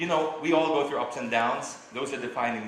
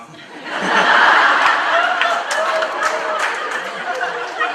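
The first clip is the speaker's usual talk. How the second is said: laugh; 11 dB louder than usual. Real, not acted. Breaks into laughter mid-talk.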